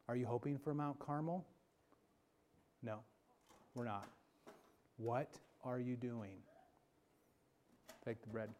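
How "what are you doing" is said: soft, low questioning; hushed still small voice; quiet voice. Acted. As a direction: ask it gently and low. Quiet voice of God.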